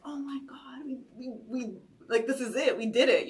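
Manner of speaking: breathless whisper building to a shout; from hushed awe to triumph; excited disbelief. Retold or imitated. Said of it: "I was like".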